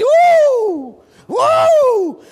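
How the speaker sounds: very high, loud, long whoops; two soaring whoops of reenacted excitement; shouted whoops. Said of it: "Just shouting".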